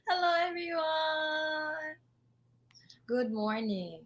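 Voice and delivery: soft, hoarse, nearly voiceless greeting; lost voice. Real, not acted. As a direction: croak it out with no voice. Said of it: "sorry, I have no voice right now".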